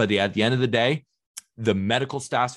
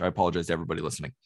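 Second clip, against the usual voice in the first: normal talking voice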